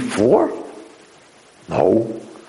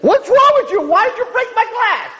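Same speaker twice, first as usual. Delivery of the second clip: high-pitched, louder mock outrage; mock angry yell; 3 dB louder than usual. Rabbi pretending to scold the floor for breaking glass, mock yelling. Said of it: "yelling at the floor".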